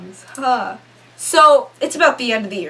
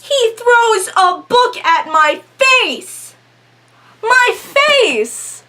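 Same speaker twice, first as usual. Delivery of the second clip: high, loud and slow, full of disbelief; outraged disbelief; 10 semitones higher, 4 dB louder, much slower than usual. Real, not acted. Telling friends about the thrown book, high outraged disbelief. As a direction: she can't believe the book flew at her face.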